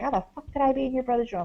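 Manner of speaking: fast, irritated mock retort; annoyed mom. Busy mom snaps at her kid, mock annoyed. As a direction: brush off the question with quick annoyance. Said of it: "she got really annoyed".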